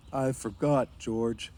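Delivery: lightly voiced slow-witted friend; sheepish Lennie. A narrator's reading. Big friend confessing he forgot, sheepish. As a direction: admit it helplessly.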